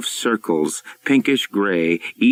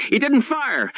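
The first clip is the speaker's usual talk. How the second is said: frightened yell. A narrator's reading. Stephanie yelling inside the sub, frightened. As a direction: cry it out in panic. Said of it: "Stephanie yelled".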